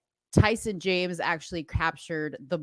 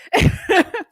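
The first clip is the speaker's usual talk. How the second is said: loud laugh; 13 semitones higher, 7 dB louder than usual. Real, not acted. Laughing at the friend.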